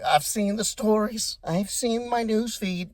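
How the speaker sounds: mock defensive, high and drawn out; Vance. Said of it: "Vance got more defensive".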